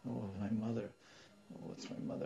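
near-whispered acted dismay; soft, breath-only murmur of a hidden boy; hushed, secret aside. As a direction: whisper it to yourself from hiding. Hidden boy reacts under the bed.

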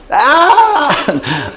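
long, high-pitched holler; high yell. Acted. Village lady yelling from the roof, high holler. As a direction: let out a far-carrying mock village yell. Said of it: "And they yell".

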